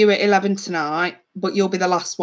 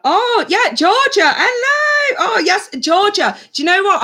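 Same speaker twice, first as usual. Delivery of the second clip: much higher, squealing hello; excited greeting; 12 semitones higher, 3 dB louder than usual. Real, not acted. Streamer spots a viewer whose email she loved, thrilled.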